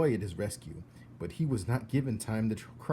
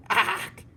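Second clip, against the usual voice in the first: disgusted cry; 7 dB louder than usual. Acted. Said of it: "said Cook".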